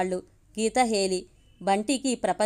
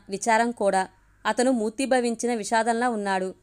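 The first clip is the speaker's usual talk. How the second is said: plain reading voice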